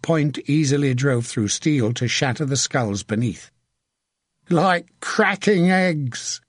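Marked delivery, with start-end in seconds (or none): 4.5-6.4 high, drawn-out crazed call. A narrator's reading. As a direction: call it slowly to no one in particular. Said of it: "he called to no one".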